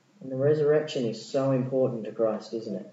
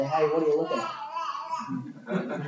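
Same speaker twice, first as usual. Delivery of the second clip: playful angel voice; 7 dB quieter, slower than usual. Acted. Angels mock the gazing disciples.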